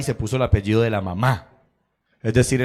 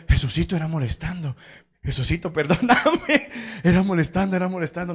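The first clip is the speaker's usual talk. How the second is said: higher-pitched mock pleading, half laughing; retold sheepish pleading; 5 semitones higher than usual. Colleague hugs him and begs pardon for teasing, mock pleading. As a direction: plead it was only teasing, playfully hammed up.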